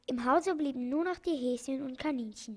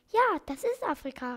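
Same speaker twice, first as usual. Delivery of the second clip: calling out; 5 semitones higher, 5 dB louder than usual. A narrator's reading. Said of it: "rief das Krokodil".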